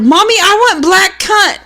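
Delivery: put-on high, demanding kid voice; mock child's shout. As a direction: imitate a child calling out to Mommy. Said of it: "He shouted".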